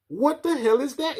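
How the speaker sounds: shocked. Real, not acted. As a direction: cry out in disbelief. Reacting to strange satellite picture.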